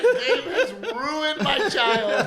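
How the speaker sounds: high, loud, very slow laughing complaint; dad wailing in mock despair through laughter; laughing mock lament. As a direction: stretch it out while cracking up. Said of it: "I'm like".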